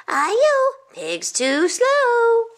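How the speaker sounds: high, loud, drawn-out singsong taunt; taunting rice cake cry. A narrator's reading. Rice cake mocking the pig, high stretched-out cry. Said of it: "It cried".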